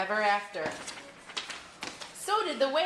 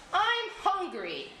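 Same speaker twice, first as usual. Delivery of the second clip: lightly voiced whale, drawn out and higher; slow, higher complaint from a storybook whale; whale character; 8 semitones higher, slower than usual. A narrator's reading. Whale announces his hunger.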